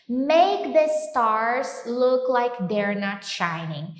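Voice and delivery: slow, careful English line; slow model reading. Shown as a demonstration. Teacher modelling the phrase slowly for students. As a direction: spell it out slowly. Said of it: "esse look like é a ideia".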